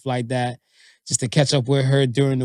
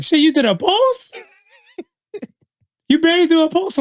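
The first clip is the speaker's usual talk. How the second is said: high, loud incredulous teasing; mock astonishment; 6 dB louder than usual. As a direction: act out exaggerated disbelief. Acting out teasing surprise at post.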